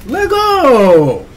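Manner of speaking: high, loud, drawn-out shout; hyped kickoff yell. Real, not acted. Host starting the video, yelling excitedly. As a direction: yell it long, falling away at the end. Kicking off the video after countdown.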